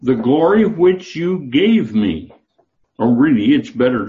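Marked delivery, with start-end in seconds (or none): 0.0-2.3 "glory" lifted high and drawn out; preacher reading slowly and dwelling on "glory"; slow emphatic reading. Shown as a demonstration. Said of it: "what does it say".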